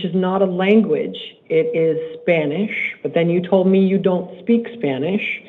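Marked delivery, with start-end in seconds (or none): none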